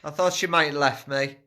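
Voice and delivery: loud, high calling. Real, not acted. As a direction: shout it down the stairs. Calling down to the group.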